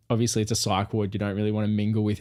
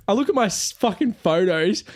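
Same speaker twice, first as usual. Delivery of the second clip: ordinary talk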